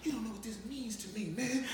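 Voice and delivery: soft, intimate gush in his ear; quiet confiding gush; whispered in his ear. Retold or imitated. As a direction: murmur it close to his ear. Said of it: "I did in his ear".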